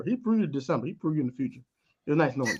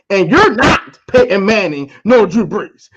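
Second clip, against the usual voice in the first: louder, slower scolding; mock scolding; 10 dB louder, slower than usual. Scolding Baker Mayfield for crying, louder and slower. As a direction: tell him off, slow and loud.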